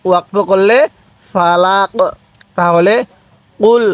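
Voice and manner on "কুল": আউযু drawn out very slowly; long chanted আউযু; slow recitation. Shown as a demonstration. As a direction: stretch আউযু long and even. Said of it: "পড়ুন আমার সাথে".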